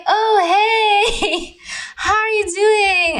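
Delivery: high, slow, drawn-out imitation through laughter; mock cheery greeting. Woman imitating her date's surprise FaceTime hello, mock cheery. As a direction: mimic him, sing-song and laughing.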